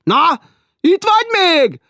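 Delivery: woman calling. Acted. Woman below checking who is there, shrill call.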